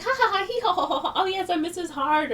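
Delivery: high, put-on giggling bride; hammed-up pretend laughter then a happy name; fake laughing. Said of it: "And she's pretending".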